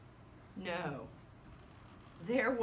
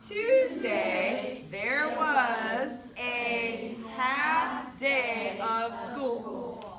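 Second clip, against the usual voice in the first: loud, drawn-out, syllable by syllable; slow choral-reading lead; 6 dB louder, much slower than usual. Shown as a demonstration. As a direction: lead a class reading aloud together, very slowly. Counts in, leads class reading.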